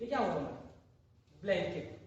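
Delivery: ordinary talk, nothing marked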